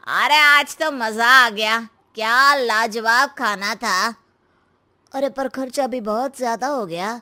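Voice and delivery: show-off husband. Acted. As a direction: play Amit, easygoing and sure of himself. Amit at party. (दिखावा करने वाला पति, बेफ़िक्र अंदाज़ में)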